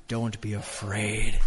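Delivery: soft, slow reassurance; gentle reassurance. Acted. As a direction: ucapkan "Don't be afraid." dengan lembut dan pelan. Quoting God's gentle words.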